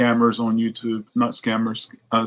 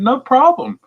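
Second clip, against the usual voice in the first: much higher, louder cheery reply; mock eagerness; 14 semitones higher, 6 dB louder than usual. Feeding the scammer, high eager agreement. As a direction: chirp it eagerly. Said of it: "And I said".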